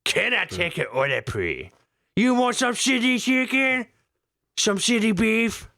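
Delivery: high mock Chinese waiter voice; mock Chinese accent. Telling his co-host what to say with the accent, as a mock Chinese waiter. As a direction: ham up a Chinese waiter offering city chicken. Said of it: "when you do the accent".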